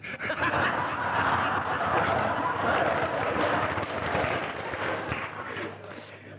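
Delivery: laughing. Real, not acted. Audience laughs at paint joke.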